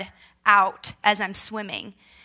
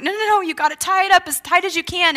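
high, loud, rushed correction; urgent instruction; 8 semitones higher, 5 dB louder, faster than usual. Retold or imitated. Injured wife directing panicking husband, urgent and insistent. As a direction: cut in fast and insistent, pitched up.

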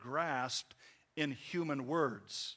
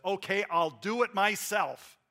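high, loud, put-upon "myself"; exasperated, raised-voice martyr act; emphatic guilt trip; 6 semitones higher, 9 dB louder than usual. Acted. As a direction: announce it loudly to guilt the listener. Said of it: "I'm trying to guilt you into something".

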